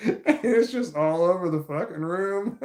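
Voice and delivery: high, loud, laughing it out. Real, not acted. Stoned vlogger describes water spraying everywhere, laughing high and loud. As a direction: crack up at the mess.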